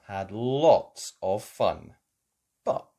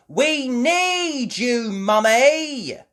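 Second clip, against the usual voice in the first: high, slow, loud calling; 12 semitones higher, 9 dB louder, much slower than usual. A narrator's reading. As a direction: call upstairs for help. Peppa calling mum.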